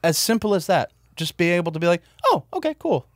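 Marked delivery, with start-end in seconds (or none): 2.2-3.1 high, bright mock "oh, okay"; breezy acceptance. Podcast host showing how to just accept someone. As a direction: shrug it off lightly, pitched way up.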